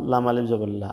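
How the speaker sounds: ordinary talk, nothing marked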